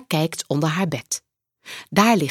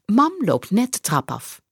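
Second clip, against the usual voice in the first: plain reading voice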